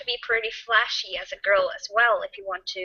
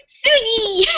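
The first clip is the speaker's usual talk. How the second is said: high, cute little call; 14 semitones higher, 4 dB louder than usual. Shown as a demonstration. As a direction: say it sweet and cute. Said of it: "sounds a little bit cute".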